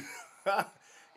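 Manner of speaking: loud, high burst of laughter; big laugh. Real, not acted. The storyteller laughs hard at the memory, loud and high.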